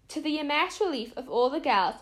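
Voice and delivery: usual speaking voice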